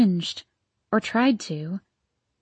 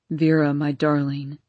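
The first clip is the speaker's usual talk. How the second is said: Arden's voice; 7 semitones lower than usual. Acted. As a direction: lower male voice, tender. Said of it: "said Arden".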